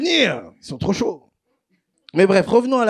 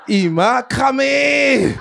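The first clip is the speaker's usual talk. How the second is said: high, drawn-out cry of complaint; exaggerated, stretched-out exclamation; comic outrage; 7 semitones higher, much slower than usual. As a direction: wail it out slowly, high-pitched.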